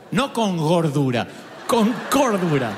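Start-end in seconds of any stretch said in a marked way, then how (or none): 1.7-2.7 cordura slowed and punched out; "cordura" drawn out with firm emphasis; stress on cordura. Shown as a demonstration. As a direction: lean hard and slowly on "cordura". Corrects gordura pun with emphasis.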